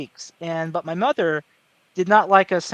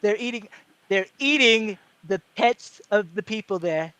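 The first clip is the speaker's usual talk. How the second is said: Trump impression; 3 semitones higher than usual. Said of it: "that's kinda how he talks".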